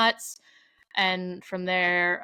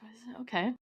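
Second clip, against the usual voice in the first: much softer and higher, a puzzled near-whisper; hushed bewilderment; 5 semitones higher, 8 dB quieter, faster than usual. Retold or imitated. Mom thrown off by dad's tears, hushed puzzled question. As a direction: ask it under your breath, thrown off. Mom unsure how to react.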